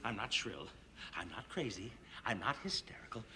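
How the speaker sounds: very low, hushed insistence; tightly controlled. Acted. Policyholder denying he is hysterical, tightly controlled. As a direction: hold it down deep and quiet, barely containing yourself. Denies being shrill, very low.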